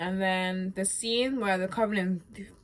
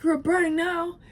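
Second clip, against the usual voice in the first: much higher, louder harsh jab; cutting Ellie; 10 semitones higher, 6 dB louder than usual. Retold or imitated. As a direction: fling the insult at her. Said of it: "Ellie's like".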